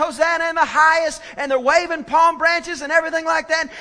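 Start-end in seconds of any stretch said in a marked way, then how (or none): 0.0-1.2 high, loud acclamation; crowd's hosanna, retold with preacher's energy; imitated crowd shout. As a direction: cry it out like the cheering crowd. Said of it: "everybody's shouting".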